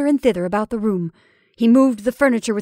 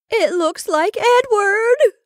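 high, loud, drawn-out wail; frenzy of fear; 13 semitones higher, 7 dB louder, slower than usual. Acted. Rebecca sees Edward in the shadow, frenzied fear. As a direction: burst out in terror. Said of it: "burst out Rebecca in a sort of frenzy of fear".

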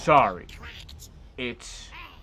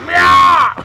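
high, very loud mock meow; mock creature cry; 14 semitones higher, 14 dB louder than usual. Player roaring as the beast in play, mock creature cry. Imitated animal cry while playing.

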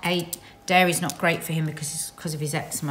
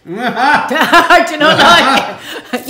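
loud, drawn-out laughing cry; 6 semitones higher, 12 dB louder, much slower than usual. Real, not acted. Woman protests through her laughter, loud and laughing. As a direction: laugh right through the words, high and loud.

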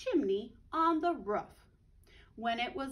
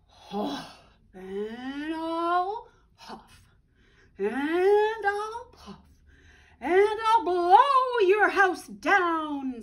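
slow, loud, higher big bad wolf voice; growling wolf threat; 6 semitones higher, 12 dB louder, much slower than usual. Big bad wolf threatening the pig, building roar.

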